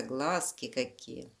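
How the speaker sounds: plain reading voice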